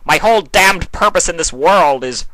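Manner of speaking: a wounded god venting hard; ranting tirade. A narrator's reading. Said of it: "King Sombra continued his tirade".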